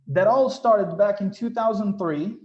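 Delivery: ordinary talk, nothing marked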